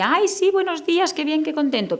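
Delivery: high sing-song greeting with hollow delight; exaggerated fake cheer. Shown as a demonstration. Distracted teacher greeting a child with fake cheer. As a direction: gush the hello with no heart in it. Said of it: "pero está pensando en que".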